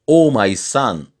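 a mild exclamation, lifted high and falling; exclaiming. A narrator's reading. Old woman pleads for a goiter cure, exclaiming.

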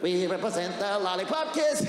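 munchkin voice. Retold or imitated. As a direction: shout it like a tiny munchkin. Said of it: "this little boy jumped in front of me and goes".

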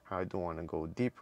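ordinary talk

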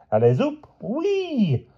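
circus call rising to a very high squeal; gleeful cry. A narrator's reading. La Gloria jumping through hoops, gleefully. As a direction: whoop with delight mid-trick. Circus rider enjoying her act.

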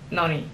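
noni falling from the first syllable, understated; low-key noni, weight on NO-, dropping off; sarcastic, first-syllable stress. Shown as a demonstration. Learner trying the sarcastic "now you've done it" noni, first-syllable stress. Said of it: "with sarcasm".

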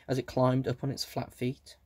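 plain reading voice

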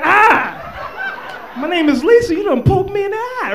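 high, loud, laughing woman; hammed-up woman, high and indignant; mock girl voice. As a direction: squeal it like a girl who got spat on. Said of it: "but she'd say".

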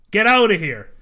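loud, sharp get out; angry dismissal. Acted. Guard ordering the rude traveller out, angry shout. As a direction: throw him out loudly.

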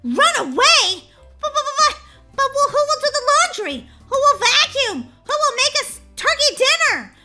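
high, loud, stammering alarm; panicked shouting. Acted. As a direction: yell in mounting panic for the family. Child panics on reading the letter.

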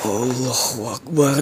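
very low, slow, hushed Allahu Akbar; hushed prayer. Real, not acted. Vlogger hearing a growl, slow hushed prayer. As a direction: breathe it out, scared.

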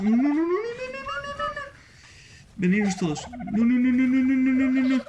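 rapid-fire alarm, pleading with the game; panicked no. Real, not acted. Gamer watching his creatures attacked, high panicked no's. Tames in danger at night.

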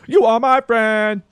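slow, loud, high quoted line; mock singalong line. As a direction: belt it slowly like a crowd chant. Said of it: "And the".

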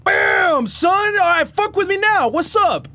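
high, loud triumphant taunt; cocky bravado. Real, not acted. Player armed with an axe taunts monsters, high cocky shout. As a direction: crow it like a challenge. Bragging after getting an axe.

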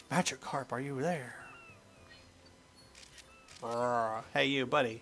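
ordinary talk, nothing marked

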